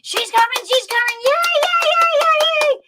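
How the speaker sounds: very high, squealed cheering; mock excited crowd. Everybody hearing she was coming online, excited squealing. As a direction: squeal it like overjoyed fans mobbing the host. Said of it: "when I told everybody that she was coming online".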